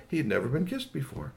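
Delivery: ordinary delivery, nothing marked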